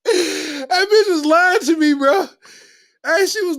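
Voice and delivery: mock-accuse it while laughing; laughing mock outrage. Real, not acted.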